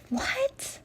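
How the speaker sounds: a sudden high, soft "what"; imitating his baffled reaction; stunned what. Kimball reading cruel posts, high baffled disbelief. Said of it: "he's just like".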